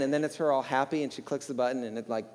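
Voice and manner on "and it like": ordinary talk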